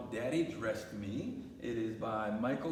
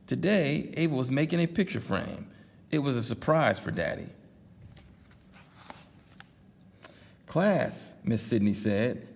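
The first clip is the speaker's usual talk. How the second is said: reading a picture book aloud, louder and livelier; story narration; 9 dB louder than usual. Acted. Dad reading a picture book to his daughters, lively storytelling. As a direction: tell it like bedtime storytime.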